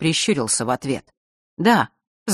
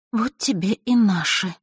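bitter thought; 5 semitones higher than usual. Acted. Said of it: "горько подумала она".

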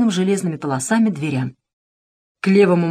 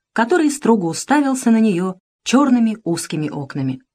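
ordinary delivery, nothing marked

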